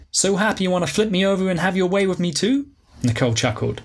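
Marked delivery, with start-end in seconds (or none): 0.1-2.7 higher, breezy flamboyant woman; Alicia, breezy diva. Acted. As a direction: sweep in like a glamorous old flame.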